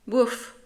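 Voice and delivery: a quick playful dog bark; woof bark. A narrator's reading. Big dog Maurice barks at the small dog, a quick woof.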